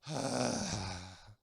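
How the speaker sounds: long, low breath of exasperation; deep contemptuous sigh. Shown as a demonstration. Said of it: "then the deep breath".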